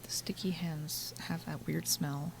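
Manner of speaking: hushed whispered aside; whispering. Real, not acted. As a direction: whisper it like a secret. Unvoiced aside about smell.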